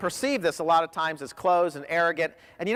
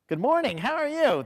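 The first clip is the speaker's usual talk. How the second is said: higher, bright smiling hello; smiling phone greeting; 7 semitones higher than usual. Retold or imitated. Phone staff greet a caller with a smile. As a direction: smile into the receiver as you answer. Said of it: "They had to smile into the phone".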